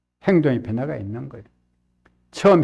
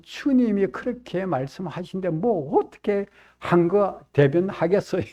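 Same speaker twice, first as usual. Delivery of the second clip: ordinary talk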